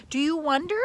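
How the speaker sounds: plain reading voice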